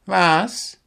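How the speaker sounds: high questioning yelp of a woken owl; a touch of startled confusion, pitched high; Willi, startled. A narrator's reading. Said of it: "fragte Willi Kautz erschrocken".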